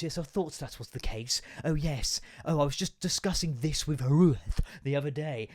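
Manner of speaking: a light imitation of his wife's posh telephone voice, every T carefully put in; lightly mock the wife's careful posh phone manner. Husband lightly mocking how his wife talks posh on the phone. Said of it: "talks in a really posh voice".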